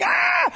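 high monkey screech; chimp screech. Retold or imitated. Chimp at cage bars, high screech. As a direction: shriek like a chimp.

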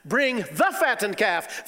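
slow, drawn-out proclamation of the father; a slow, stately call for the feast; father's command. Acted. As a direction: lay it out slowly like a grand order.

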